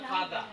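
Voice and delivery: "ফাদা" with the final r dropped; British pronunciation. Shown as a demonstration. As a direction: end "ফাদা" on an open vowel, no r. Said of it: "এটাকে বলে ফাদা".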